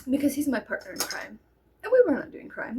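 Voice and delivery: usual speaking voice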